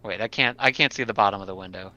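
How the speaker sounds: ordinary talk, nothing marked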